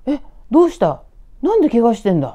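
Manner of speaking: loud, high-pitched alarmed questions; startled. Retold or imitated. Storyteller as roommate seeing a bloodied friend, startled and loud. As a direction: blurt it out in shocked concern. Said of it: "びっくりして".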